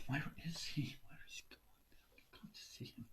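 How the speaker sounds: whispered, anxious wondering; worried whisper. Acted. Wife waiting at home whispers her worry. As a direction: murmur to herself, fretting.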